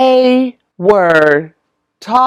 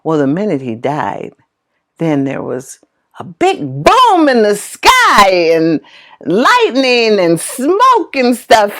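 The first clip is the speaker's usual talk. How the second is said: normal talking voice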